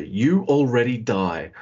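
slow, drawn-out catchphrase impression; slow mock threat, half laughing; Taka Michinoku. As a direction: imitate a wrestler's pre-match threat, drawing out each word. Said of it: "Taka, before every match".

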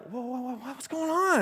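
groggy passenger jolted awake, high and alarmed; panicked whoa. Retold or imitated. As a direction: wake up in alarm. Waking in a plunging plane.

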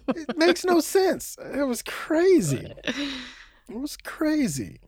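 drawn-out dismay, trailing off; exasperated disbelief. Real, not acted. Repeating it in disbelief.

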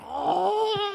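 high surprised squeak; sloth squeak. Pretend. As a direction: let out a tiny sloth squeak. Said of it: "the sloth goes".

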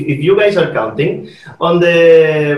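usual speaking voice

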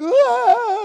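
sudden high cry of emotion; emotional cry. Retold or imitated. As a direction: let out an overwhelmed cry. Overwhelmed seeing wife cry.

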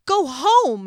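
high, very loud command; hollering. A narrator's reading. Elf pushing through a brawl orders people away, hollering. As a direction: holler it over a rioting crowd. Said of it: "he hollered at people".

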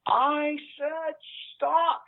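high-pitched mock yell from the commander; retold yelling. Commander yelling at his sergeant to halt the raid, high mock yell. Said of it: "And he starts yelling".

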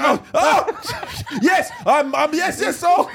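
very high, flustered, stammering outburst; hammed-up panic breaking into laughter; mock panic. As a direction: flail through it in comic alarm. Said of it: "you panic".

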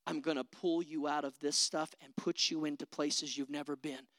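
a low, calm, reassuring voice of God. Pretend. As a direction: speak softly as God reassuring a frightened believer. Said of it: "That's the voice of God".

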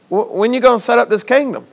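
imitating disciples, soft and quick; hushed question. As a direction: ask it softly and hurriedly, as the disciples. Voices disciples' question to Jesus.

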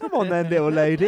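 hammed-up challenge, egging on an opponent; mock taunt. Grown child squaring up to mum, loud mock taunt.

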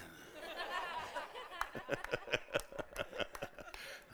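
unvoiced chuckle; breathy laugh. Real, not acted. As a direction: silent laughing. Laughs at own joke.

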